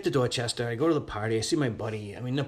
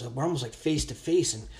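ordinary delivery

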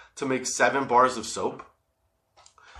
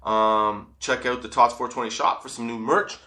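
ordinary talk, nothing marked

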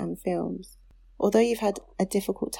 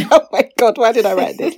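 loud reading that collapses into laughter; laughing too hard to carry on; cracking up; 4 semitones higher, 12 dB louder than usual. Real, not acted. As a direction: break off laughing in embarrassed disbelief. Cracks up reading own letter.

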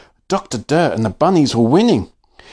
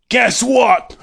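high, gleeful villain taunt; gleeful shout; 9 semitones higher than usual. Acted. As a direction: crow it with glee. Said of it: "Doctor Dirt shouted with glee".

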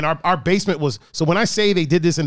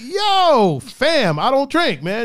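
amused playful refusal; 6 semitones higher, 3 dB louder than usual. Retold or imitated. Retold refusal with playful threat.

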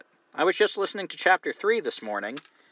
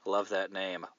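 ordinary talk, nothing marked

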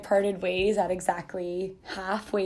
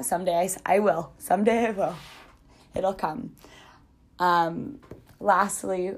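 usual speaking voice

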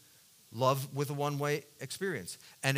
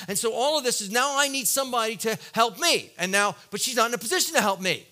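loud, higher, urgent sermon build; preaching at full force; 5 semitones higher, 6 dB louder than usual. Acted. Preacher describing his anger and loneliness to the congregation, loud and urgent. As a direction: drive the point home with rising intensity.

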